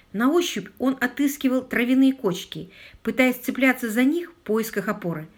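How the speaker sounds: ordinary delivery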